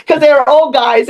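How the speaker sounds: usual speaking voice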